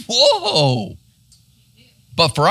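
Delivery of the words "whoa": awed whoa. Real, not acted. Awe at the thief's faith.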